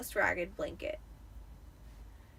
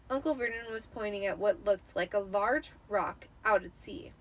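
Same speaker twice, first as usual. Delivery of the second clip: usual speaking voice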